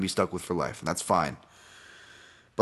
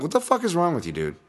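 mock yell, pitched much higher; retold angry outburst; 10 semitones higher than usual. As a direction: fire it back at him, higher and sharp. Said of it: "I'm like".

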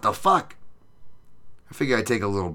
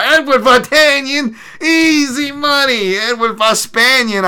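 much higher, louder, slow put-on hype voice on easy money; mock catchphrase; 11 dB louder than usual. Shown as a demonstration. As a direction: belt it like a cartoon announcer. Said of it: "would have been easy money".

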